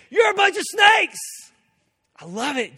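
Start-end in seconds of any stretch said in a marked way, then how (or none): 0.1-1.2 high, loud accusing shout; Jesus shouting. Retold or imitated. Preacher acting Jesus scolding a crowd, full shout. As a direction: hurl it at them like a prophet. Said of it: "He looked at people and said".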